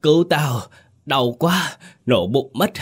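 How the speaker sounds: high, loud pained moaning cry; groaning for help. A narrator's reading. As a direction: moan like a man whose belly is bursting. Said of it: "Ông Hội rên rỉ". (rên rỉ kêu cứu vì đau bụng)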